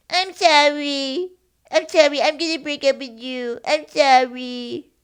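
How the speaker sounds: put-on loud, high baby-talk breakup; babyish voice. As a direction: dump someone in a drawn-out baby voice. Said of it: "turn into a baby".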